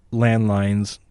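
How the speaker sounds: usual speaking voice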